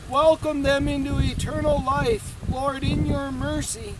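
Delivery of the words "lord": usual speaking voice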